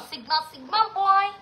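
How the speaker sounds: a mild sneering imitation of trendy kids; mocking sigma boy. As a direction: drag out "sigma boy" in contempt. Mocking what rude kids want.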